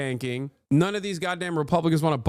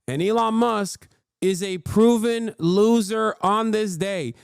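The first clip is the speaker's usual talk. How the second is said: ordinary talk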